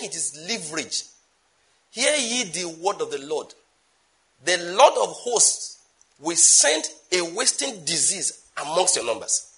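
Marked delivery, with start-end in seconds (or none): 1.9-9.5 slow, solemn preaching of the Lord's word; prophetic proclamation. Acted.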